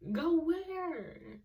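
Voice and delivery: high comic outrage; squeaky disbelief. Retold or imitated. Repeated, pitched way up.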